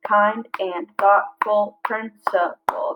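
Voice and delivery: slow, each syllable spaced out; slow syllable-counting read. Shown as a demonstration. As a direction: clap out each beat. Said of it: "that's seven".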